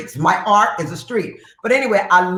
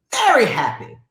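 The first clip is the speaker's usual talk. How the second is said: normal talking voice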